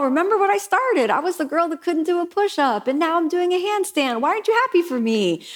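high, hammed-up complaint; student whining at her teacher for approval; whiny self-pity. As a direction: plead for praise like a sulking student.

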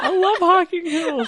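high, laughing protest. Real, not acted. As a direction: laugh through the line. Defending it while laughing.

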